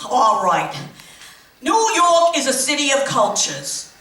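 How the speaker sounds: ordinary talk, nothing marked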